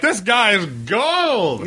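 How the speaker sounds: high, drawn-out mock rave; mock gushing audience. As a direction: gush slowly like an overawed spectator. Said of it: "they're just like".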